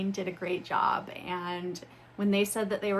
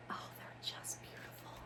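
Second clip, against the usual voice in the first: awed whisper; 16 dB quieter than usual. Real, not acted. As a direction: breathe it out in wonder. Admiring the books.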